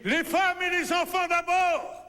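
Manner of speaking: high, drawn-out alarm shout; crying out. Acted. Artist on sinking plank ordering evacuation, high shouted command. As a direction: call it out slowly and loudly over the ship. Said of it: "Il a envie de crier".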